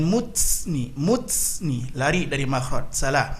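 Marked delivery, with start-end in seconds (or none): 0.0-1.9 "muts ni" with the t hissed into ts; wrong t sukun. Shown as a demonstration. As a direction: show the mistake, "muts ni" hissed. ("muts ni" dengan ta berdesis, contoh salah)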